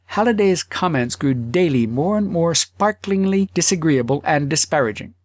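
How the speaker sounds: ordinary delivery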